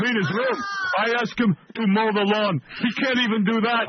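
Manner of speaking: hammed-up griping dad; mocking dad. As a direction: whine it like a fed-up father. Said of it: "they would discuss in front of me".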